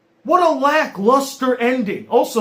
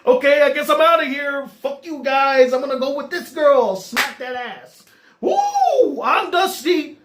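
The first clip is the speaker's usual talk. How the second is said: mock oblivious jerk, higher-pitched; hammed-up carefree exit with a high whoop; Dusty; 5 semitones higher than usual. Reviewer mocks Dusty outing himself, hammed-up careless jerk. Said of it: "which was just".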